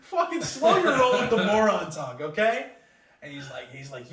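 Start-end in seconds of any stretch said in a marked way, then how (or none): 0.0-2.7 loud, high mock-outraged rant; indignant protest. Patient telling paramedic to ease off insults, mock indignant. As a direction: scold with hammed-up exasperation. Acted protest at being called moron.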